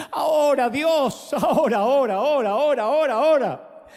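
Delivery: pressing, rhythmic chant of each word; rhythmic revival chant; urgent repeated call. Acted. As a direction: drive the crowd on, word after word. Urging congregation repeatedly.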